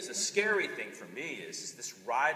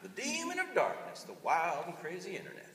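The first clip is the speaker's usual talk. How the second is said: lightly voiced Texan reading an old warning; a touch of Texas accent for a quoted warning; 6 semitones higher than usual. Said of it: "I read this with a Texas accent".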